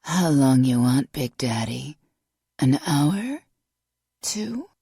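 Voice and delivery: loud, rough come-on rasp; brassy slurred smoker's voice; raspy smoker voice. A narrator's reading. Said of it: "her voice a rough parody of itself".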